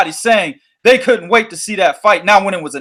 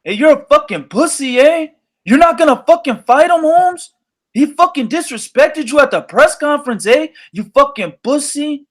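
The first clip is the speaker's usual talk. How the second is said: put-on higher, taunting Mexican accent; taunting fans in a put-on Mexican accent; mocking fans; 5 semitones higher than usual. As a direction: jeer like heckling Mexican fans. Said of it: "the Mexican fans were like".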